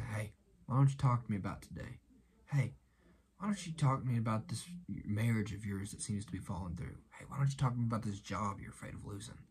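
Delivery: low, soft, quick bedside coaxing; confiding voice of a comforter pulling up a chair; gentle invitation. Acted. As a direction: softly invite them to open up. Jesus pulls up a chair, invites talk.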